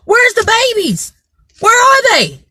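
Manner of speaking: high, panicked calls for his family; frantic brother. Retold or imitated. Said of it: "he comes running up the street and he's like".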